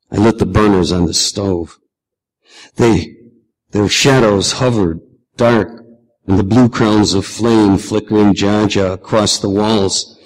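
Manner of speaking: plain reading voice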